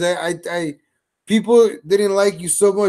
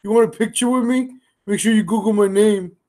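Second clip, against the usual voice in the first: flattered squeal on the oh, then higher pleased gushing; mocking Jovi; 4 semitones higher than usual. Streamer mimics Jovi taking a compliment, flattered and higher. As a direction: play the gullible guy lapping up praise. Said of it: "He'll be like".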